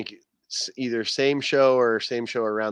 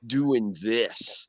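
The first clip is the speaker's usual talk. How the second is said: put-on old manager; much slower than usual.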